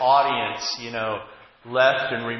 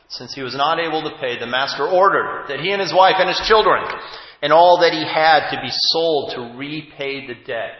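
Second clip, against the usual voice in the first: loud, weighty reading of the parable; scripture reading; 3 semitones higher, 9 dB louder than usual. Acted. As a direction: proclaim the scripture to the congregation.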